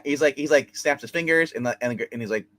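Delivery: normal talking voice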